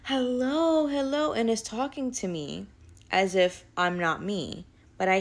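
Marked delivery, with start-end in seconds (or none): 0.0-1.3 a much higher, put-on voice greeting someone else; gushing as if meeting an old friend; imitating the possessed elder. Elder greets the speaker as someone else, high put-on voice. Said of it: "She was like".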